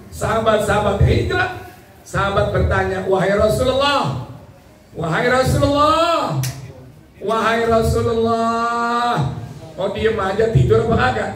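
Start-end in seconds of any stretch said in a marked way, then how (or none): 3.1-9.4 calling out. Acted. Companion calling the Prophet.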